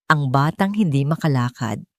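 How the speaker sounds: ordinary delivery, nothing marked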